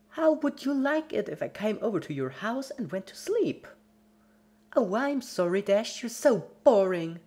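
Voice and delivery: high, loud, whiny scolding rant; pouty angry Pinky. Acted. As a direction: lash out like a spoiled child. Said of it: "lashed out at her helpless victim".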